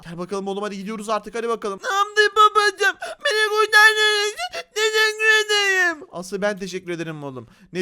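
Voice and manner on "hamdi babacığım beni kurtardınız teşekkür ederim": put-on high, slow, whiny child; drawn-out, high mock-tearful voice; little son voice. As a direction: wail thanks as a rescued little kid. Rescued son thanks father.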